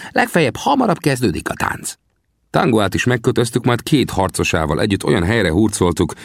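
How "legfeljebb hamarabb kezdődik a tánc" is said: high-pitched character voice; Sam, consoling. A narrator's reading. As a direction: make light of it in a high voice. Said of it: "vigasztalta Sam". (magas, vigasztaló figurahang)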